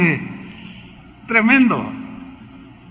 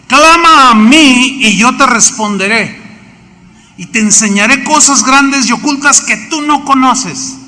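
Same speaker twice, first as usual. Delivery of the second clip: proclaiming scripture; 6 semitones higher, 5 dB louder than usual. Acted. Preacher proclaims God's promise.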